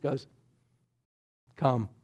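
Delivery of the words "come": low, soft single word; hushed, low-key call; simple invitation. Retold or imitated. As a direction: say it quietly and low, understated. Jesus simply calls Peter onto water.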